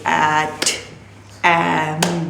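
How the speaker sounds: at and am each drawn out as a single beat; at, pause, am, each slow and level; one-beat sound blends. Shown as a demonstration. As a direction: stretch the vowel in at and am, then close it. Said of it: "clapping का एक जो वो concept".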